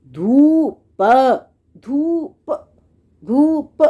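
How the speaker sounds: धूप repeated with a clear long ऊ; pronunciation demo. Shown as a demonstration. Teacher showing children how to say धूप, the ऊ held. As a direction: say धूप three times, stretching the ऊ. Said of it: "ऐसे बोलोगे".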